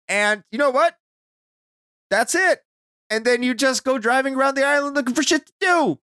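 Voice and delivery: high-pitched exasperated complaint; worked-up, indignant recap; incredulous rant. Real, not acted. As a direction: push the pitch up in disbelief. Incredulous rant about missing plot.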